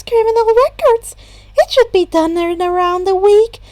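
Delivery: a principal running a meeting; brisk Netsu. A narrator's reading. Netsu voiced high.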